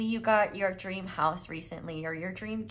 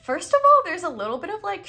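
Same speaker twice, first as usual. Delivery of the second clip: excited; 11 semitones higher, 10 dB louder, faster than usual. Real, not acted. Restarts with sudden burst.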